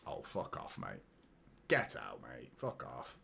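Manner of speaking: hissing it away from the mic; muttered swearing. Real, not acted. Fan at the telly, muttering a real fed-up fuck off.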